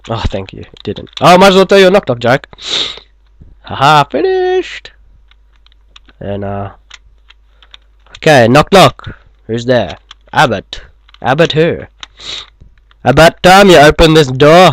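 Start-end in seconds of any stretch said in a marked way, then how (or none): 1.2-2.5 rushed, high-pitched and loud; fast and high. Acted. Same line, higher and faster. 3.6-4.9 squeaky slow victory cry; high voice. Acted. 13.0-14.7 loud. Acted. Knock-knock punchline, loud.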